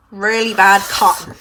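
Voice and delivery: loud, broad Suffolk accent. Real, not acted. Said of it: "That's my Suffolk accent coming through then".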